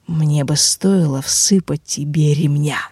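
low, slow undead menace; inhuman rustling voice. Acted. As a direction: drag it out like a burnt lich. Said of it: "прошелестела тварь нечеловеческим голосом".

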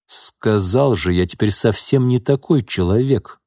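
ordinary delivery

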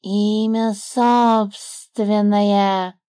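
slow drawn-out smug snake voice; the snake pleased with himself. A narrator's reading. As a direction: say it proudly, tapping his chest. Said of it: "довольно постучал себя".

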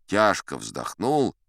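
plain reading voice

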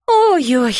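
drawn little oh-dear of disappointment; mildly upset. A narrator's reading. Fairy mum after her spell fails, mildly upset. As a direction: let a touch of dismay fall through it. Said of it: "огорчилась мамочка-фея".

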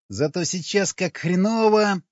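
high, moaning hungover complaint; pained high moan; groaning. A narrator's reading. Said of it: "простонал Сквернослов".

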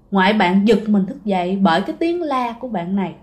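ordinary talk, nothing marked

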